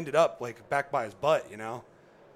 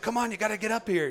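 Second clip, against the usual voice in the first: high, eager urging; mock guide hurrying him on; retold guide urging, higher; urging call; 8 semitones higher, 4 dB louder than usual.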